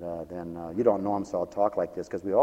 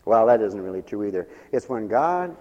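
usual speaking voice

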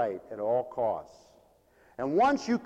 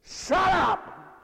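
higher, sharp mock teen yell; boy's shout; 12 semitones higher, 5 dB louder than usual. Angry boy yelling back through fence, mock teen shout. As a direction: snap back like an angry kid. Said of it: "and he says".

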